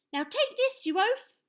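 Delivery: put-on high, loud boyish taunt; little knight. A narrator's reading. Young knight soars above the giant, defiant. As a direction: shout the taunt in a child's high voice. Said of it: "he said".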